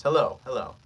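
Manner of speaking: ordinary delivery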